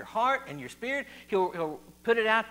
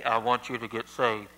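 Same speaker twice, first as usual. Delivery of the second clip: much lower, booming mock voice of God; 8 semitones lower, 3 dB louder than usual. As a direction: drop deep and play God calling you. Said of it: "That's my best God voice right there".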